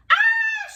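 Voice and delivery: very high, loud terrified shriek. A narrator's reading. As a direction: scream in sudden terror. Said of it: "shrieked Flap".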